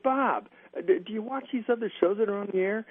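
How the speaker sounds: high, pleading, half-joking whine; pleading complaint. Retold or imitated. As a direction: beg the boss with mock outrage. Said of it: "I said".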